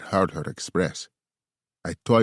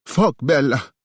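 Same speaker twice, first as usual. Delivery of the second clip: loud cry; 9 semitones higher, 6 dB louder than usual. A narrator's reading. Male climax in love scene.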